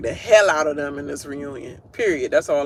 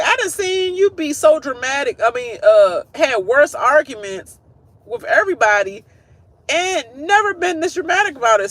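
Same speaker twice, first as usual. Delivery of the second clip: mock scolding; 8 semitones higher, 4 dB louder than usual. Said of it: "she was like".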